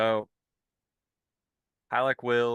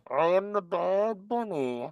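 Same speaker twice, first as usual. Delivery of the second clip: Bane voice; 7 semitones higher than usual. Pretend. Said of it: "now you've become Bane like me".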